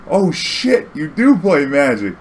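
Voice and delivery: amazed realization. Retold or imitated. Stripper sees his draft deck, stunned slow amazement. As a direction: she finally believes him, stunned.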